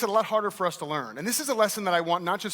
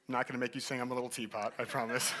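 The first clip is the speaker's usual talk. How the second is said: much lower and softer, a bit fast; quiet aside; 6 semitones lower, 10 dB quieter than usual. Real, not acted. Speaker reassures audience, soft quick aside. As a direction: tuck it away as a private aside.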